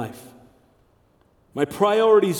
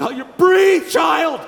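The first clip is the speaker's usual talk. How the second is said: put-on high, loud exclamation at the child; alarmed cry urging the baby to breathe; panicked parent; 9 semitones higher, 8 dB louder, slower than usual. Parents alarmed at a baby not breathing, high loud shout.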